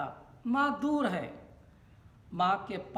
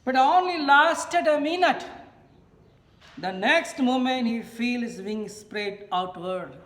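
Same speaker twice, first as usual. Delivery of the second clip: louder, higher, slow read-out; declamatory reading aloud; 5 semitones higher, 8 dB louder, much slower than usual. A narrator's reading. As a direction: read it out slowly to a class, projecting. Reads lesson text aloud.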